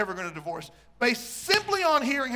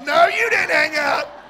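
much higher, louder, slow squeal; squealing late night call; 8 semitones higher, 12 dB louder, slower than usual. Retold or imitated. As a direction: squeal it at your sweetheart. Acting out late night phone call.

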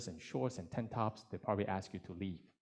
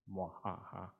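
mad scientist laugh; 2 semitones lower, 7 dB quieter than usual. Acted. Evil laugh after Jacob's boast.

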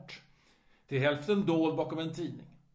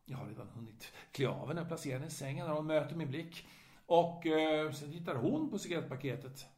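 ordinary delivery

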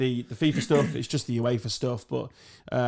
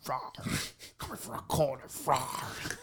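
soft breathy dinosaur roar; mock dinosaur pouncing on a corner; 10 dB quieter, slower than usual. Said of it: "that was a dinosaur".

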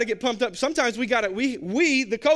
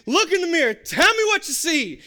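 commanding; 7 semitones higher, 4 dB louder than usual. Retold or imitated. God voiced as commanding.

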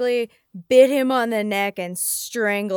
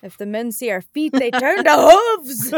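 mock dramatic voice; 3 semitones higher, 9 dB louder than usual. Jokes as the hoofed women.

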